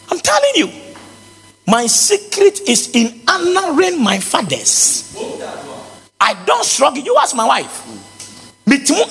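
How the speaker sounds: high, slow preaching declaration; emphatic preaching. Acted. Pastor declaring his secret to the church, high and emphatic. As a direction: proclaim it high and sure.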